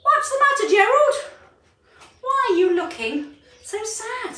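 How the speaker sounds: a high, gentle little cricket voice; kind cricket. Acted. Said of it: "the cricket asked him".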